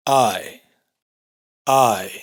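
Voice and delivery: "Ai" de A cerrándose a I abierta; diptongo islandés. Shown as a demonstration. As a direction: deslizar "Ai" de A hacia I abierta. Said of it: "empieza con un sonido de A y se va cerrando". (diptongo "Ai" de A a I)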